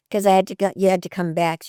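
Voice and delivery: ordinary talk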